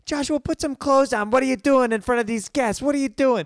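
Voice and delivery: high, loud scolding mother; upset mom. Retold or imitated. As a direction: scold him like his mortified mother, pitch way up. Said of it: "his mom gets so upset".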